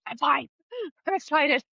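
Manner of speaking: high-pitched, giddy reassurance; excited. Retold or imitated. Mother reassuring her three-year-old after screaming, excited and high. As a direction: squeal it through a laugh. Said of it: "I screamed a little bit".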